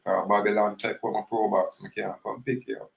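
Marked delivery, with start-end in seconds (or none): none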